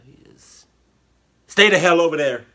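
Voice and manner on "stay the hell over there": loud, high, drawn-up warning; mock warning. Reactor warning off the paint licker, loud mock warning. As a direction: call it out loud, keeping someone away.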